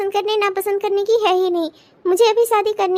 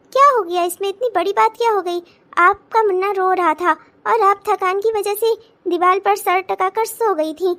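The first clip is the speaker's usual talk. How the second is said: usual speaking voice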